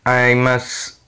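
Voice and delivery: ordinary talk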